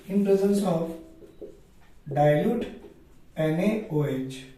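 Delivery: ordinary delivery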